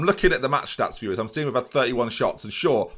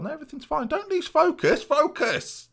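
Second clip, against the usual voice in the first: much higher, urging the team to stay sharp; mock manager voice. Manager urging his players to keep focus, in a high put-on voice. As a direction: play a fussing manager telling the squad to focus.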